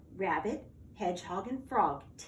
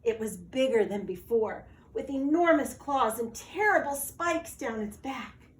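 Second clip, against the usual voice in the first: ordinary delivery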